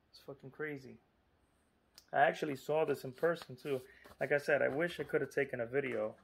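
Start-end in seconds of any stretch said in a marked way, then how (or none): none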